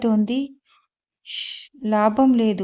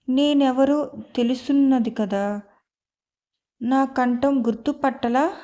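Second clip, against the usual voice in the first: usual speaking voice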